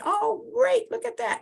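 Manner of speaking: light up at the sight; delighted. Real, not acted. Host sees the audience's hearts pop up, delighted.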